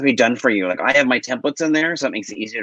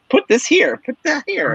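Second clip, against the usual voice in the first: much higher, singsong instruction voice; mock template voice. Explaining templates to a podcast host, in a high singsong mock voice. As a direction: parody a fussy template telling you what goes where.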